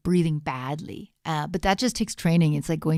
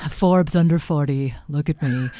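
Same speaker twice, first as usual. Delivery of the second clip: louder, boastful put-on voice breaking into a laugh; Elizabeth Holmes voice; 5 dB louder than usual. Still doing Elizabeth Holmes, a louder boast that ends in a laugh. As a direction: show off like a founder, laughing. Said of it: "You can sound like Elizabeth Holmes".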